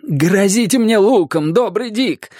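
high, drawn-out plea; pleading. A narrator's reading. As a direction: beg for mercy in a high voice. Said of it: "взмолился он".

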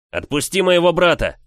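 furious shout at the bully; yelling. Acted. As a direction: yell it with full force. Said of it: "заорал я".

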